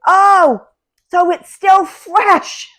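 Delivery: high, booming, drawn-out holler; very loud high-pitched shout; loud yelling. Retold or imitated. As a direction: bellow it slowly to someone hard of hearing. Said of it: "everything I say is loud".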